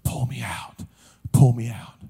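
very low, faint last words; dying whisper. Retold or imitated. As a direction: imitate a dying man's whisper, with pauses. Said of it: "I could just hear the whisper".

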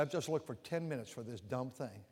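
low, soft muttered rebuke to himself; quiet retold self-talk. Preacher retelling scolding himself while searching, low and soft. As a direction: mutter it to yourself, almost ashamed. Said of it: "I said".

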